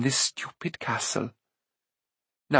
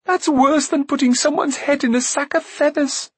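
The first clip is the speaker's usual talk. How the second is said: hurt accusation climbing steadily higher; tearful outburst; 10 semitones higher than usual. A narrator's reading. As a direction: build from wounded to near-wailing, pitch very high by the end. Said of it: "Tears suddenly filled her eyes".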